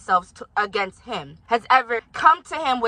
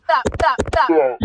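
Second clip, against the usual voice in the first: exasperated pleading; 3 semitones higher, 6 dB louder, slower than usual. Real, not acted. Pleading with the elder to stop.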